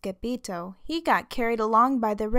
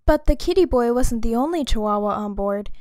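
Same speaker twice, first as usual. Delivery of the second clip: plain reading voice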